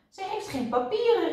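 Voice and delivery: high, irritated outcry; annoyed protest, pitched well up; cross. A narrator's reading. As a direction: call it out crossly. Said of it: "riep Kwark nijdig".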